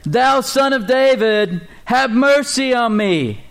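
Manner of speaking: high, slow, pleading cry; desperate slow cry for mercy. Acted. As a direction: beg loudly across the crowd. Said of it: "he cried all the more".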